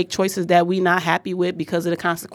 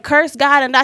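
higher, a bit louder wife's jab; mock nagging wife; 6 semitones higher, 4 dB louder than usual. Job's wife tells him to give up, mock scornful and higher.

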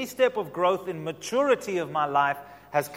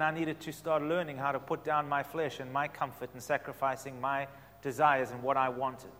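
usual speaking voice

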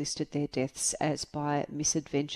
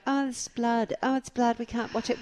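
high whiny mimicry; mock the squeamish family; 6 semitones higher than usual. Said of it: "they're all going".

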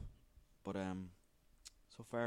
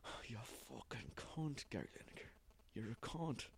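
imagined muttered insult; 9 dB quieter than usual. Pretend. Host imagining insulting Gary Lineker, muttered under his breath. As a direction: mutter it so he can't hear. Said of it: "No, I didn't, though".

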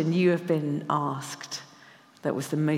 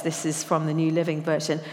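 ordinary delivery

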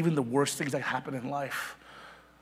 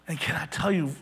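ordinary talk, nothing marked

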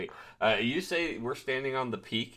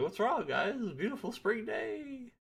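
oblivious cheery voice; 12 semitones higher than usual. Retold or imitated. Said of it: "I was like".

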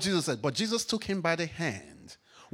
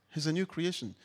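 ordinary delivery

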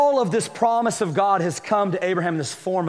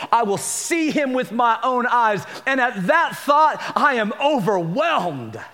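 rising preaching; 4 semitones higher than usual. Acted. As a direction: preach it building to the end. Preaching Job's hope.

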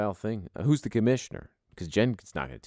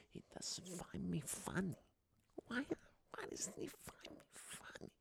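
whispered Sebastian Maniscalco impression; 18 dB quieter than usual. Podcaster keeps mocking the comedian in a whispered hurt aside. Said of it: "my shitty Sebastian Maniscalco impression".

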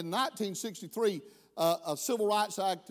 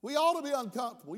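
normal talking voice

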